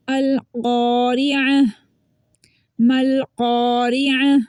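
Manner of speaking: slow, held क़ारिअह with rounded qaf; heavy qaf recitation. Shown as a demonstration. Teacher reciting a Quran example, full-mouthed qaf. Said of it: "कॉफ को भी हम मोटा पढ़ेंगे".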